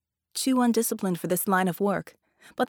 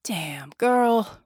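weary, louder exasperation; exasperated sigh; 5 dB louder than usual. Acted. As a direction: let the frustration sigh out. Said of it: "Red sighed".

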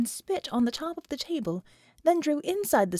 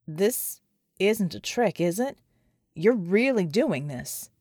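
dazed soldier; 4 semitones lower, slower than usual. Acted. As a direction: lower and slow, disbelieving. John slowly accepts it.

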